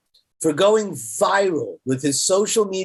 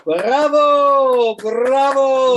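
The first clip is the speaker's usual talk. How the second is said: loud, high cheering bravo; delighted, loud double bravo; 5 semitones higher, 5 dB louder, much slower than usual. Real, not acted. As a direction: cheer him on like a crowd. Host cheers guest's arrival.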